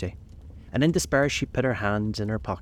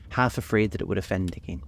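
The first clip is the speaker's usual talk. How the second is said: ordinary delivery, nothing marked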